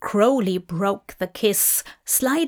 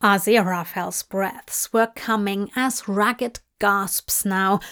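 ordinary delivery, nothing marked

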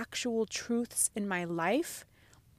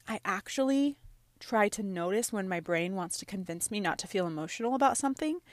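normal talking voice

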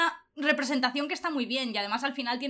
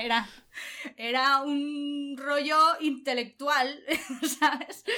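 laughing; 3 semitones higher, much slower than usual. Real, not acted. Laughing at own joke.

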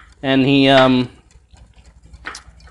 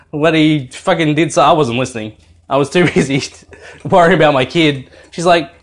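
usual speaking voice

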